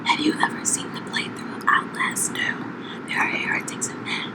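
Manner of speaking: whispering. Acted. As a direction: whisper it spookily. ASMR segment, describing game.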